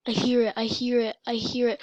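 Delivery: ordinary talk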